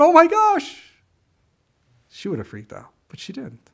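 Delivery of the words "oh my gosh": high, loud mock panic; mock freaking out. Imagined mom panicking over the hurt child, loud mock alarm. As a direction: play the panicking mom for effect. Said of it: "if her mom was freaking out".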